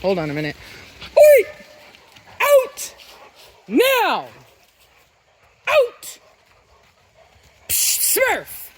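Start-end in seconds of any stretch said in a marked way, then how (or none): 1.2-8.7 very high loud barked commands; dog command voice. Real, not acted. Owner using her loud command voice on the dogs, very high. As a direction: project each word like a whip crack.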